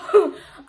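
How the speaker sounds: loud high laugh; burst of laughter. Real, not acted.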